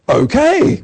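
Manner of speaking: a sudden high, loud "okay"; delighted okay. Retold or imitated. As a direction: jump at the offer, high and bright. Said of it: "And I went".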